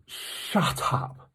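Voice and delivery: breathy stunned shut up; gasped, unvoiced disbelief at the news; mock disbelief. Telling the stall lady about the pancakes, stunned disbelief. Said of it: "I went".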